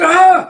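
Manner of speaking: high, loud yelp of burning pain; sudden high cry of agony; pained scream. Acted. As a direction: shriek as the fire hits. Hunter set on fire by blast.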